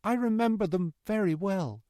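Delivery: put-on old prisoner.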